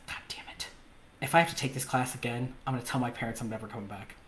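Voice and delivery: retold low, very soft griping; muttered grumbling. Pupil called over by teacher, muttered grumbling to himself.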